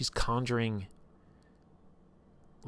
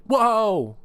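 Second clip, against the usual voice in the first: a much higher, loud startled whoa; shocked whoa; 13 semitones higher, 10 dB louder than usual. Retold or imitated. Party sees Twitch crush a head with a hammer, loud shocked whoa. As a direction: yelp of alarm at the gore.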